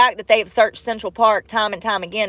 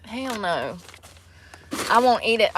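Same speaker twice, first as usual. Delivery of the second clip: ordinary delivery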